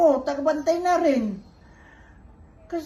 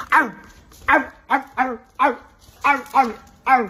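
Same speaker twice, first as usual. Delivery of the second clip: dog bark demo. Shown as a demonstration. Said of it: "Papa na lang magbabark". (malakas na kunwaring tahol ng aso)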